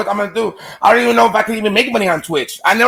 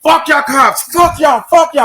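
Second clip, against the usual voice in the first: high-pitched, raised-voice cursing; retold angry yelling; 7 semitones higher, slower than usual. Locked-up inmate cursing the cops while banging, pissed off. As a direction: re-enact hollering at the cops through a door. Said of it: "I was so pissed off".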